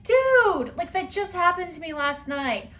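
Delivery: high, loud "Dude!" then settling; outraged at the intrusion; exasperated shout. Retold or imitated. Shouting at the dog who barged in. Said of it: "you're like".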